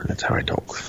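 whispered repeat of the line. Shown as a demonstration. Repeating his dog's line in a whisper.

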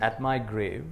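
slow, measured reading of a poem, line by line; poem recitation. Acted. Teacher leads the audience through a memorized poem, slow recitation. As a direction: recite it slowly so the room can echo you.

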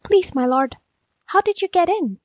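high, rising, meek query; timid question. A narrator's reading. Jackal asking the impatient tiger, timid and high-pitched.

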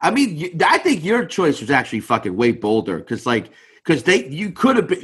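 usual speaking voice

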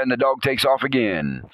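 ordinary talk

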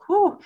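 high loud blown whew; modelling an exhale for a child; demo whew. Shown as a demonstration. Demonstrated breath.

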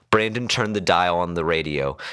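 plain reading voice

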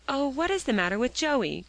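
mildly anxious, high question; distressed cry. A narrator's reading. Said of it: "cried Phronsie".

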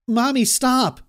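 put-on high, loud pleading child; a scared girl begging her mother to stop; frightened cry. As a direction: daughter crying out as her mother grips her arm. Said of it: "cried Gabby".

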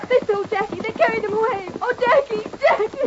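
distraught. Acted. Sister distraught after kidnapping.

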